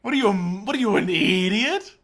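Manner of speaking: loud scolding comic voice; mock outraged host. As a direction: berate the contestant theatrically.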